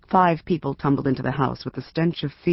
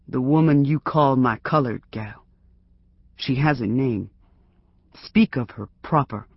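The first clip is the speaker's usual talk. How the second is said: calm, firm and unmoving; unmoved. Acted. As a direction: set him straight without heat.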